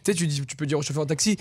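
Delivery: ordinary talk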